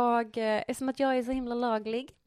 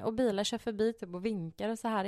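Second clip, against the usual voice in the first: ordinary talk, nothing marked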